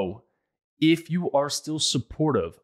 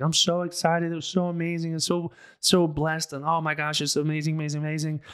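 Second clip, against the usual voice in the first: higher, gushing excitement; excited Megan; 6 semitones higher than usual. Retold or imitated. Wife back from training, gushing higher. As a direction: bubble over with joy, pitched up. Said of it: "she's like".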